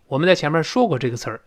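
ordinary talk, nothing marked

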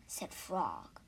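plain reading voice

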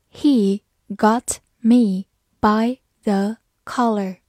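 slow, careful sentence read. Shown as a demonstration. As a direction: read it slowly for learners. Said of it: "slow then normal reading".

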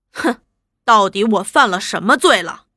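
ordinary delivery

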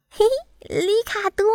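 high-pitched little bumblebee call. A narrator's reading. Bumblebee hails his caterpillar friend, calling high. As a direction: call out high, without much volume. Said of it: "小熊蜂大叫道".

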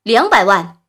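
loud, emphatic auction bid; calling a bid. A narrator's reading. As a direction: slam down a bid loudly.

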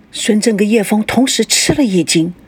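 ordinary delivery, nothing marked

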